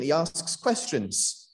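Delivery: usual speaking voice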